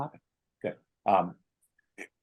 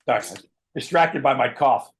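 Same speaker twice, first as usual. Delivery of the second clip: usual speaking voice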